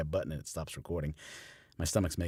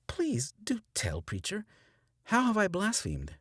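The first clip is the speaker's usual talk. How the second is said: much higher, slow and sugary; sweetly mocking woman. A narrator's reading. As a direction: bait him with sweet false calm. Stella calmly baiting preacher.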